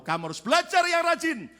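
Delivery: high, loud scolding order; firm admonishing. Retold or imitated. Child told to study hard, firm admonishing. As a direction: drive the order home forcefully.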